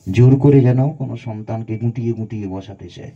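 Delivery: ordinary talk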